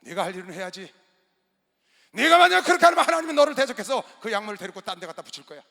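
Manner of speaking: 높고 크게 몰아치는 꾸중; 설교하듯 크게 다그치기. Retold or imitated. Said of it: "너무나 제가 화가 났었어요". (전도사를 크게 꾸짖는 목사)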